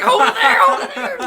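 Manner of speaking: high, loud urgent command; frantic order. Retold or imitated. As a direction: mom barking to hold it. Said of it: "she was like".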